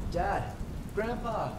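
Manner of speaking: high-pitched, distant-sounding yell; calling out. A narrator's reading. Lost hunter to his dad and grandpa, high distant yell. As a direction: call for them from far off, high but held back in volume. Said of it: "I yell out".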